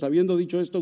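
ordinary talk, nothing marked

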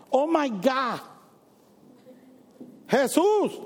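sudden high cry of surprise; startled exclamation. Retold or imitated. Preacher mimicking atheists crying out in shock. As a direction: blurt it out like a startled unbeliever.

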